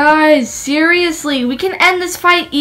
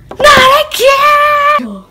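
high, drawn-out wail of despair; anguished cry; 13 semitones higher, 5 dB louder, much slower than usual. Acted. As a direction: cry out slowly in dismay. Despairing cry after eating again.

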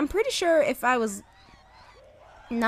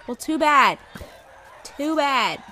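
mock taunt; 8 dB louder, slower than usual. Taunting a mob.

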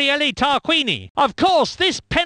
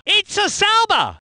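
higher, punched car name; excited identification; 5 semitones higher than usual. Acted. Commentator spotting a Sauber, excited high call.

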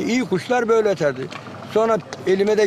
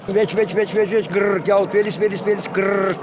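bird song demo. Shown as a demonstration. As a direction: imitate a songbird with a gırr finish. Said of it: "Abi şimdi şakşağını ötüyor". (titretmeli kuş taklidi)

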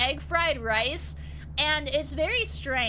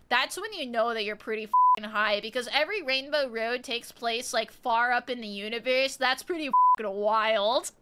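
ordinary talk

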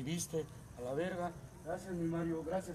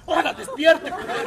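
mock wake-up yell; 6 semitones higher, 9 dB louder than usual.

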